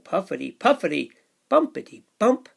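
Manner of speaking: put-on lively read-aloud for little kids; sing-song storybook rhythm; bouncy picture book narration; reading to children.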